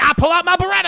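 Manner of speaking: high, very loud mock threat; hammed-up hollered warning; shouted threat. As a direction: yell it as if drawing a gun. Acted armed threat.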